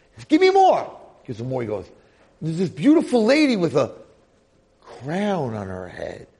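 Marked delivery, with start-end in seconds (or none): 0.2-1.0 high, loud desperate shout; sudden acted outburst, pitch leaping way up; urgent demand. Demands more of the memory drink. 2.4-6.3 loud, high burst slowing into wonder; acted amazement at a returning memory; awestruck recollection. Amazed vision of crowned lady.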